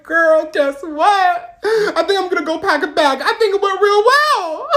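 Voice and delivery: high, drawn-out sarcastic brightness; mock cheerfulness. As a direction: sing out fake optimism, stretching the words. Sarcastic verdict after dad's outburst.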